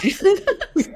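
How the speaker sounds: sudden high soft giggle; high laugh. Real, not acted. Coach laughing at Americans and metric, high giggle. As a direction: burst into a quick high giggle. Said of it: "Sorry about that".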